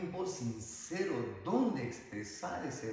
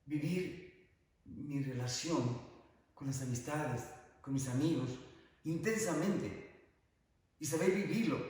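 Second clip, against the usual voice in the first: normal talking voice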